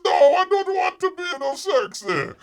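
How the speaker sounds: very high, loud squeaky bear voice; mock bear refusal. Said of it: "And Bear says".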